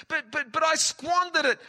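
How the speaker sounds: very high, loud stammering protest; protesting son. Acted. Prodigal son objecting to his father, high and loud. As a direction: sputter it out in disbelief.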